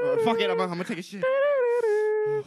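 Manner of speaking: put-on high, strained desperate kid; panicked kid voice. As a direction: squeak it out like you can't hold it. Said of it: "kid panicking in bathroom".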